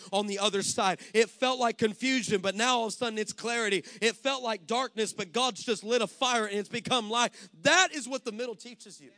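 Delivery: loud, rising, rhythmic sermon; preaching at full force. Acted. Pastor encouraging his church, loud and building. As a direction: build it up, proclaim hope.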